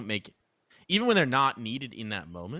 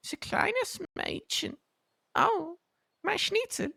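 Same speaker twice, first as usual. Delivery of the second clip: very high, squeaky German falsetto; put-on German accent; slower than usual. Shown as a demonstration. Caster shows co-caster another German accent, high falsetto. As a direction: coo it slowly in a sky-high German voice. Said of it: "I have a few German accents".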